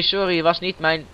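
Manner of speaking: usual speaking voice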